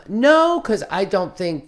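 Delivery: high, loud no; emphatic no. Real, not acted. Answering the interviewer's question with a loud, high no. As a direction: shoot the idea down hard.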